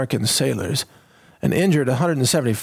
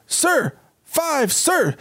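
mock dim sailor; 11 semitones higher, much slower than usual. Mock sailor answering wrongly.

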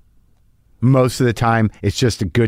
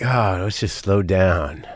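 put-on much slower and softer, sun-drunk; lazy slowed-down drawl; 6 semitones lower, 4 dB quieter, much slower than usual. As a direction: melt into the heat, drag every word. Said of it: "I'm more like, sort of like".

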